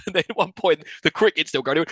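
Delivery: ordinary talk, nothing marked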